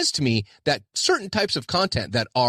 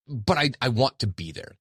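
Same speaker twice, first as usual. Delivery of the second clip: ordinary talk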